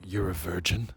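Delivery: low, soft disbelieving question; mock brooding Edward. Edward questioning Bella, mock-brooding and low. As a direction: drop into a hushed, brooding male voice. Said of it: "Edward's like".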